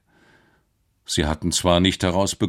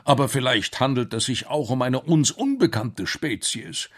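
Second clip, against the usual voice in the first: higher, animated scientist voice; excited storybook professor, pitched up; eager professor; 7 semitones higher than usual. Acted. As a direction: play a hopeful professor explaining the plan.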